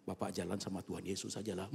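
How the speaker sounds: ordinary delivery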